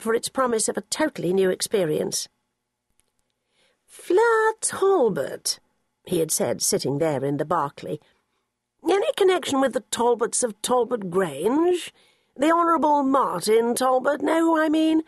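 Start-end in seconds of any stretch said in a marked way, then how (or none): 3.9-5.6 put-on high, very slow, mannered old gent; a distinguished old man sizing her up; elderly gentleman. Sir Quentin addresses Fleur at interview. 8.9-15.0 put-on elderly snob. Narrator voices Sir Quentin.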